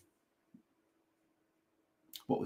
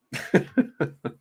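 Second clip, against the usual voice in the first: high-pitched giggle; guilty laughing; 6 semitones higher than usual. Real, not acted. Commentator who shouldn't be laughing at this, laughing. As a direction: laugh when you know you shouldn't. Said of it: "I shouldn't be laughing".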